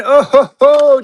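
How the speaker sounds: high, loud excited chuckle; gleeful burst as the bid lands. Real, not acted. Host thrilled as David wins, high loud oh ho ho.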